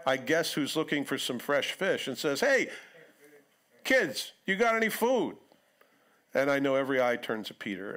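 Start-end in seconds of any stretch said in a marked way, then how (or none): 2.4-5.4 high, loud call across the water. Retold or imitated. Stranger on shore calling to fishermen, high loud hail.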